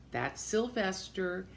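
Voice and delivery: ordinary delivery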